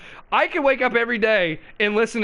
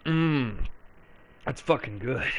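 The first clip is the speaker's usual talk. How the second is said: soft, lower, savoring sips; mock coffee sipper; 7 semitones lower, 8 dB quieter than usual. Host sips coffee like Joe, quietly savoring. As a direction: drink and murmur contentedly. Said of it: "take a quick sip of this coffee".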